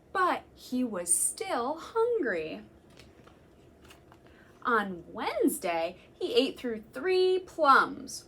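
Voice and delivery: slow, emphatic storytime reading; reading to children. Acted. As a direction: savour each food for the little listeners. Reading picture book to children.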